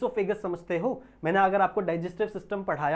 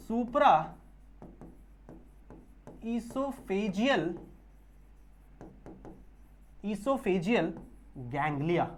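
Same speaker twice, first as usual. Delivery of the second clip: drawn-out term, spaced syllable by syllable; dictating the term word by word; slow dictation; 6 dB quieter, much slower than usual. Shown as a demonstration. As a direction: spell the name out slowly for notes. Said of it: "ये तीनों को मिलाकर बच्चों हम कहते हैं".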